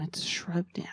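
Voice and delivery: breathy whispered punchline. Acted. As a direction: whisper it like a secret. Whispered punchline after "rub down.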